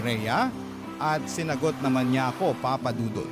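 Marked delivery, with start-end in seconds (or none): none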